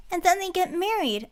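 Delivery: put-on high, eager girlish blurt; excited interruption. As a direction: cut in with delight. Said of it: "Anise interrupted, her eyes twinkling".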